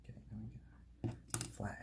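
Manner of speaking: soft unvoiced whisper. Real, not acted. Fetching a screwdriver, in a whisper. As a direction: whisper it to yourself.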